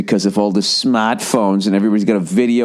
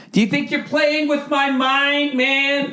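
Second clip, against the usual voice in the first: mock high taunting voice; slower than usual. Mock lecture to smart-ass kid.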